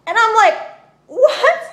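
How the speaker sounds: sudden high, loud disbelief; shocked. Retold or imitated. Stabbed woman reacts to a voice in her head, shocked. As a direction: squeal out a startled what.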